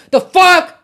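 high, repetitive exasperated outburst; venting rage. Real, not acted. Streamer needs to take the rage out, angry high ranting. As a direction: let the anger out in repeated bursts. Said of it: "मुझे कहीं पे अपना गुस्सा निकालना पड़ेगा".

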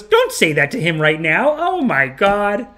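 slow, rising exasperated rebuke; mock scolding. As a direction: imitate a protective co-star telling someone off. Co-star scolds director, acted.

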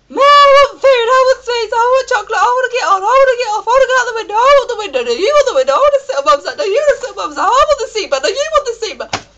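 loud, very high bratty wail; whining kid. Retold or imitated. Kids pestering Mum on a plane, mock screaming whine. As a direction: squeal the demands like squabbling children, hammed-up. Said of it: "you got this kid going".

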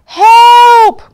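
high, very loud yell for help; loud, drawn-out storybook scream; frightened cry. A narrator's reading. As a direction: cry out in panic, long and high. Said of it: "cried the animals".

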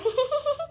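short, high burst of tickled giggles; ticklish giggle. Acted. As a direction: giggle as if your foot is tickled. Foot tickled again. (गुदगुदी से छोटी सी ऊँची हँसी)

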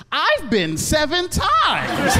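put-on high, slow, smug little kid. Second grader bragging to his teacher, high and slow. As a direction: drag it out like a bragging child. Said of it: "then he goes".

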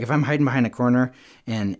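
usual speaking voice

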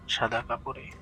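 ordinary delivery

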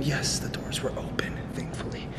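hushed relieved whisper. Real, not acted. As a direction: breathe it out in a whisper. Whispering the lucky find.

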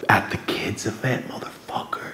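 furious whisper, all breath; seething. Retold or imitated. Confronting a rival at a kids' event, seething whisper. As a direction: whisper the curse in disbelief. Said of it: "so fucking violently mad".

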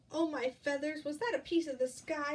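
high, loud little squeal; squeaky startled chick cry. Acted. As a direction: squeal it in alarm. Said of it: "he squealed, squeaked".

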